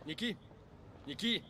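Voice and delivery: high-pitched, questioning call. Acted. Man calling after his departing girlfriend, searching call. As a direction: call after her with a rising tune.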